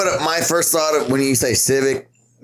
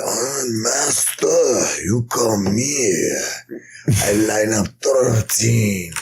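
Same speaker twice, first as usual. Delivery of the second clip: put-on drawn-out, quavering old master voice; hammed-up eighty-year-old martial arts master, very slow; ancient master; 3 semitones lower, much slower than usual. Old master telling a pupil about thirteen boards, hammed-up ancient voice. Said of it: "fucking eighty years old. He's like".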